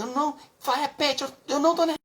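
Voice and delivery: loud, very high outraged denial; stammering mock-furious yell; imitated angry outburst. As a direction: mimic a wife shrieking that she is calm. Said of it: "quase quebrou a mesa".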